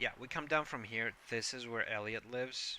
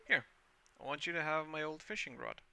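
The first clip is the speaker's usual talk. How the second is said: put-on higher, kindly old sea-dog; warm old fisherman Willy; 6 semitones higher than usual. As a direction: hand it over like a proud old fisherman.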